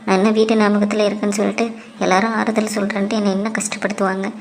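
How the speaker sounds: low, soft inner voice; inner thoughts. Acted. Worried wife thinking about her mother, soft and low.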